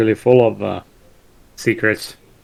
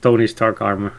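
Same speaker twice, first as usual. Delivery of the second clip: ordinary delivery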